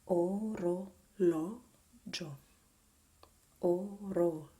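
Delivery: orologio loud, syllables apart, open stressed LO; stressed-syllable demo. Shown as a demonstration. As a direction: break up orologio as "oh, roh, LAW, jo". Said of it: "you have the accent on".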